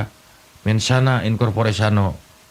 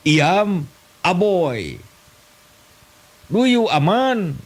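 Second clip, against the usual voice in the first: much higher, slow mock English; broken English; 8 semitones higher, much slower than usual. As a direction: ham up clumsy English. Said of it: "Bahasa Inggris tapi kepakojot". (bahasa Inggris ngawur, nada tinggi dibuat-buat)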